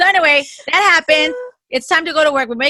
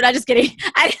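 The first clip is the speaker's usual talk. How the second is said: normal talking voice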